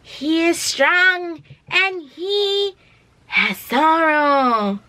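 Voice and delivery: very slow, high-pitched wren voice; high birdlike impression, stretched right out; Mantis. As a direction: draw every word out in a high, odd little voice. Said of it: "She's like a high-pitched wren".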